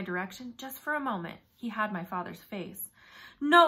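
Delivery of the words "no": urgent. A narrator's reading. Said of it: "I thought again".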